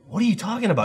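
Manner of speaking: high-pitched retold bafflement; baffled. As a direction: replay it in confused disbelief. Retold baffled reply to her.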